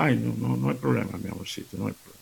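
husband soothing, trailing to near whisper; fading mock reassurance.

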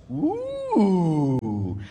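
high, long sliding "ooh"; mock-impressed ooh. Priest mocking a student's reason to believe, fake-awed ooh. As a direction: coo in exaggerated pretend awe. Mocking awe at kid's answer.

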